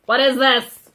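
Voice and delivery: loud, incredulous what is this; mock outrage at the reading material; exasperated outburst. Real, not acted. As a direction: throw up your hands in disbelief. The repeated question bursts out loud.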